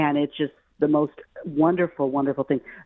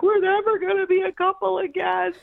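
hammed-up weepy whine, very high-pitched; tearful wailing. Pregnant wife melting down to her husband, mock tearful wail. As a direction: imitate your own high, sobbing meltdown. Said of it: "I start crying".

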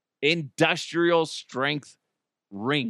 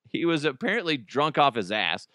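ordinary talk, nothing marked

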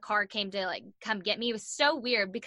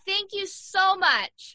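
slow, higher, mock-gracious thanks; awkward thanks; 9 semitones higher, 5 dB louder, much slower than usual. Cheerleader awkwardly thanks drunk fans in jail, slow and mock-gracious. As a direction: drag it out, embarrassed politeness.

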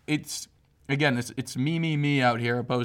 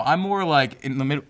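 ordinary talk

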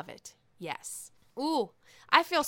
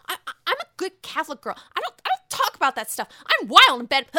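quick, higher prim Ramona voice that ends louder; fast imitated Ramona; 6 semitones higher, 8 dB louder, faster than usual. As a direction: rattle off the good-girl line, then burst out. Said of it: "Ramona saying to Leah".

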